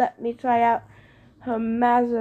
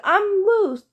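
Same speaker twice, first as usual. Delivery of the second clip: tense denial; 9 semitones higher, 6 dB louder than usual. A narrator's reading. Thalia tense, denying it.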